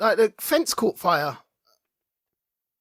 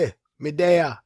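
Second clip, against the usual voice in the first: mock Jamaican greeting; 6 semitones lower than usual. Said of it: "as I say in Jamaica".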